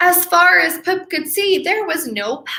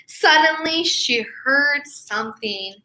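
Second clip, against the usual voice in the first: ordinary delivery, nothing marked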